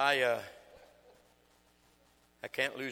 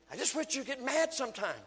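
wife's plea; 14 semitones higher, 8 dB louder than usual. Retold or imitated. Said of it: "my wife will say".

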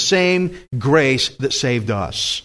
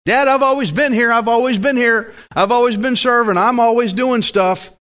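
put-on older son to his father, higher-pitched protest; older son complaining; 7 semitones higher than usual. Older son pleading his record to Dad, complaining. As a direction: list your service in a higher, insistent voice. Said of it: "The older son's relationship was based on works".

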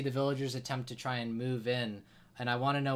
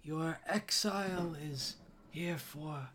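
a higher, put-on voice for the village elder; cold and smug, a pitched-up elder voice; 5 semitones higher, 3 dB quieter than usual. The Elder gloats to the exiled hero, higher and cold.

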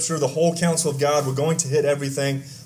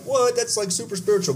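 mock surprise; 7 semitones higher than usual. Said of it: "I'd be like".

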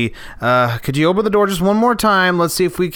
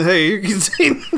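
laughing imitation; 4 semitones higher than usual. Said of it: "I like how he walks in".

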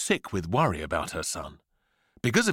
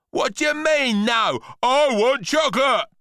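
high, loud demanding brat; outraged boy; 8 dB louder than usual. A narrator's reading. Boy is refused chocolate by mum, loud outrage. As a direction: shout it in a spoilt tantrum.

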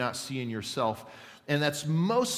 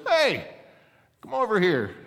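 very high, loud parrot call; loud comic parrot summons; squawking parrot hail; 10 semitones higher, 9 dB louder than usual. Acted. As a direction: squawk it across the pet shop. Parrot hails customer a second time.

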